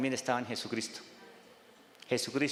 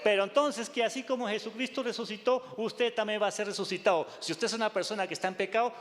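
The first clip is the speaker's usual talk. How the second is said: fervent pulpit exhortation; preaching at full force; 7 semitones higher than usual. Acted. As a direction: press the point home to the flock. Preacher proclaiming the resurrection.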